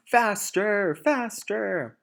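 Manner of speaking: high, loud urging; shout. Acted. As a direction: drive them on frantically.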